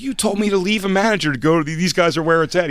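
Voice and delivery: high, loud, fast complaint; hammed-up indignant protest; mock protest. Complaining to Patrice, high fast mock protest. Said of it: "I was like".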